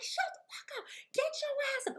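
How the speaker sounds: high-pitched mock yelling; mock cussing out. Baby mama in the car cussing him out, high mock yelling. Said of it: "cussing him out".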